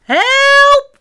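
very high, loud, drawn-out cry; storybook little sister's piercing plea; calling for help. A narrator's reading. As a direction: yell for help at full alarm. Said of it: "roept zusje Sam".

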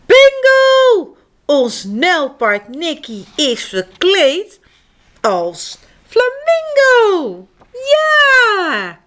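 high, loud triumphant shout; exclaiming. A narrator's reading. Egbert solves the riddle, high triumphant shout. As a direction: shout out the answer. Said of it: "roept Egbert".

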